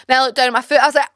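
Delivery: normal talking voice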